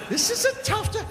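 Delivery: very high, squeezed mock dismay; high-pitched repeat. Comic repeats verdict on the rough town, squeaky high. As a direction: shoot the pitch way up for comic alarm.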